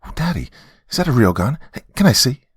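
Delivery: put-on high, eager child voice; excited little girl. A narrator's reading. Daughter asking Daddy about gun, excited. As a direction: exclaim like a thrilled small girl. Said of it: "while excitedly exclaiming".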